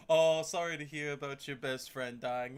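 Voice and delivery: normal talking voice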